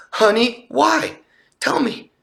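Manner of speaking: high, concerned wife coaxing; high mock-tender wife inviting him to talk; caring wife. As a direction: ask gently but pitched high, eager to hear.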